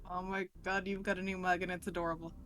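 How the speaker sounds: ordinary delivery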